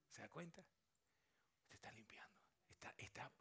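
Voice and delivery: breathy, intimate whisper; soft whisper. Real, not acted. Pastor gently telling the listener they are healing, whispered. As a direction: whisper it gently, as if to one person.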